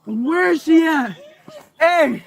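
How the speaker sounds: ordinary talk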